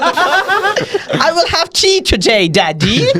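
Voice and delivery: high, drawn-out mock British child; laughing, hammed-up British kid voice; ridiculous British accent. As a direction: stretch it into a silly British accent. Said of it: "ridiculous British accent".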